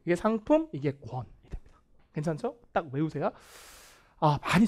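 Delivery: ordinary talk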